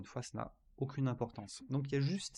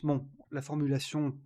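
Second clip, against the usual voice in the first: ordinary talk, nothing marked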